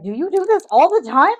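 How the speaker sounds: loud, high imitation of distressed husband; mock-horrified high yell; retold incredulous outburst. Husband overwhelmed at Costco asking his wife, mock appalled.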